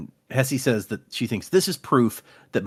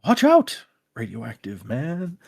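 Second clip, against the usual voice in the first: cartoon catchphrase impression; slower than usual. Quoting a cartoon for laughs, put-on character voice. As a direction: call out, then stretch the name slowly.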